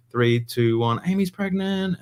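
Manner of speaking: high, rising countdown reveal; announcing the news. Retold or imitated. Telling the whole family, high announcing voice. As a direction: build the countdown, then spring the news. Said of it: "and I was like".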